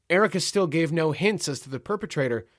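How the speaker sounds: ordinary delivery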